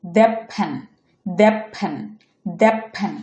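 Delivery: দ্যাট said "dep" before ফ্যান; assimilated T. Shown as a demonstration. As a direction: end দ্যাট on p, straight into ফ্যান. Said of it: "এটা উচ্চারণ হবে".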